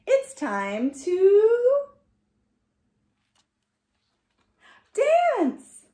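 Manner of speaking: slow, then a high loud burst on dance; rising excitement that lands on dance; building to a cheer. Acted. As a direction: draw it out, then explode into the last word. Story's big finish.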